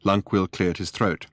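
ordinary delivery

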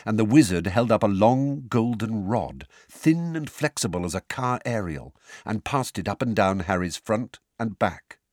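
plain reading voice

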